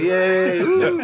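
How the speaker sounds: high, loud whooping cheer that jumps way up; imitating a crew cheering a take; mock cheering. Crew cheering after the bottle knock, high whooping cheer. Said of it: "Everybody comes out clapping".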